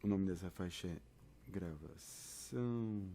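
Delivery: ordinary talk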